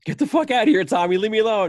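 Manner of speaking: loud, high mock shove-off; laughing shout. Henry breaking the tension, loud and high. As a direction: shout it off as a joke. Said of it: "And he's like".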